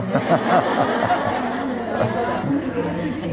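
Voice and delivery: light laugh at the haggling; amused laugh. Real, not acted. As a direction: chuckle at his cheek. Preacher laughs at bargaining.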